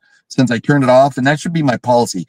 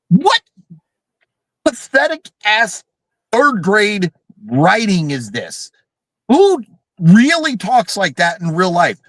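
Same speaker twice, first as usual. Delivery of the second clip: high, incredulous outburst, slowing at the end; exasperated disbelief; 6 semitones higher than usual. Real, not acted. Host rants at the movie's dumbest line, exasperated disbelief. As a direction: pause after each piece in exasperation.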